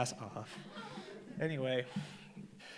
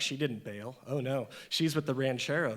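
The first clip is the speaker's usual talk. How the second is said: ordinary delivery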